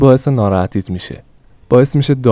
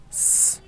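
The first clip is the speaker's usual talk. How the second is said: sharp unvoiced shush to silence him; soft hissing shh; hushing shh; 13 dB quieter than usual. A narrator's reading. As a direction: hush him quickly, breath only. Hushing husband during sale.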